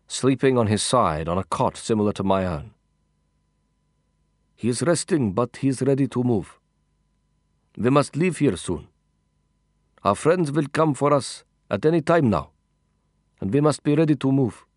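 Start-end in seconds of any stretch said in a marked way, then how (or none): 4.6-14.7 put-on higher, accented companion voice; brief him in accented English, pitched up; lilting accented character voice; foreign-accented companion. Voices Mahmoud briefing the narrator.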